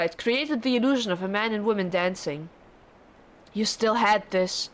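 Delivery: usual speaking voice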